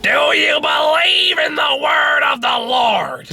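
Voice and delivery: high, slow, squawking comedian impression; Gilbert Gottfried. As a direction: stretch it out in a strained screech. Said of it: "Gilbert Gottfried did a Jesus movie".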